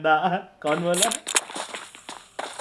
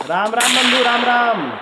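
high, loud call of hello; hearty shouted greeting; 6 semitones higher, 6 dB louder, slower than usual. Real, not acted. Streamer greeting a viewer, hearty shout. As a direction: call out a big, drawn greeting.

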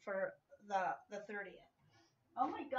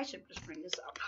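whispered aside; 5 dB quieter than usual. Real, not acted. Whispered aside about bringing the toy along. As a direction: mutter it to yourself.

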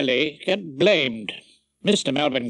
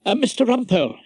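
a hurried, slightly raised summons; urgent; 7 semitones higher, 3 dB louder, faster than usual. A narrator's reading. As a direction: hurry the barrister back to court. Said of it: "he said urgently".

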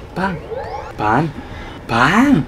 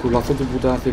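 normal talking voice

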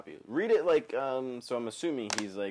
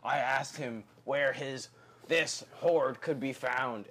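louder, slow hammed pirate read; 4 semitones higher, 6 dB louder, slower than usual. Shown as a demonstration. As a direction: drag it out like a pirate. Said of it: "read it like a perp".

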